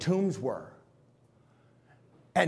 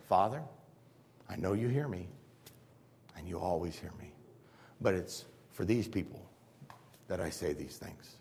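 low, soft, reverent prayer; Jesus prays quietly before the tomb; 9 semitones lower, 9 dB quieter than usual. Retold or imitated. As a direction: pray it softly to the Father.

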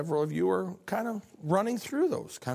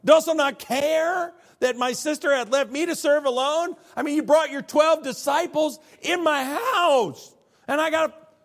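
high, loud, exasperated complaint; frustrated outburst; 11 semitones higher, 8 dB louder than usual. Acted. Martha complaining to Jesus, high and loud with exasperation. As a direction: vent it at the guest who brought twelve men. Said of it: "she said".

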